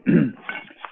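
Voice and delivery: rough cough after choking; throat clearing. Real, not acted. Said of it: "something went down the wrong pipe".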